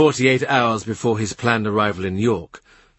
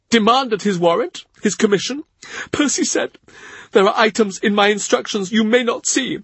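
much higher grieving servant's voice; tearful Cavendish; 10 semitones higher than usual. A narrator's reading. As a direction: lift it high like a tearful old retainer. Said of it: "He cries as he talks".

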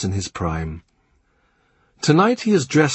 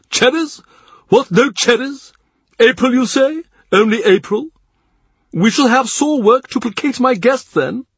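much higher, louder, theatrical prelate; the Cardinal, mock dismay; 6 dB louder than usual. A narrator's reading. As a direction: play up the fuss over cherries. Narrator voices the Cardinal.

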